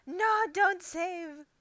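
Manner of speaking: high, loud gasping protest; real flustered alarm, high and loud; panicked cry. Real, not acted. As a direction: cry out to stop it at once. Panics at software saving wrongly.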